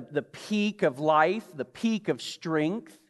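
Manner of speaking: slowed, weighted \"peak\" each time; slow emphasis. Acted. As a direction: lean on the peak. Stressing youth's peak.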